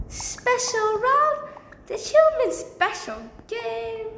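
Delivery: high, drawn-out student voice; acted student voice. Student questions the teacher's announced round, acted student voice.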